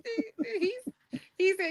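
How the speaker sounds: high, quiet laughter breaking up the words; laughing. Real, not acted. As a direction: try to talk through giggles. Laughing at the misquoted line.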